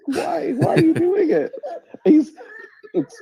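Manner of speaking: high-pitched, laughing mock plea; exasperated squeal breaking into laughs. Mock pleading through laughter.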